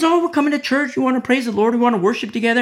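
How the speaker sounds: high, gushing churchgoer imitation; exaggerated chirpy enthusiasm; mock eager churchgoers.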